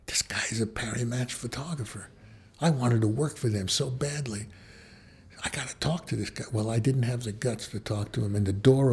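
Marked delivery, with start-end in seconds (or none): 0.1-6.4 unvoiced private whisper of thought. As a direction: whisper it to yourself, excited but secret. Inner thoughts on the train.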